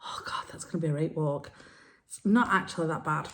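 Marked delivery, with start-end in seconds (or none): 0.0-1.5 hushed, quick, whispered aside to oneself; dreading thought. Retold or imitated. Walker eyeing the hill from home, whispered dread. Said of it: "you look and you think".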